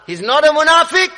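loud, high preacher's warning; forceful preaching. Real, not acted. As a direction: ring it out to the congregation.

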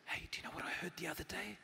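hurried, secretive whisper. Acted. Friend in an empty room shares news, whispering. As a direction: whisper it like passing on gossip. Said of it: "You just whisper".

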